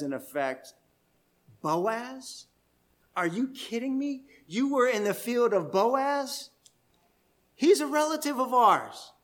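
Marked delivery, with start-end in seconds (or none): 1.6-9.1 higher, thrilled are-you-kidding-me; overjoyed at the news of Boaz; excited disbelief. Retold or imitated. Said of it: "she is even more excited".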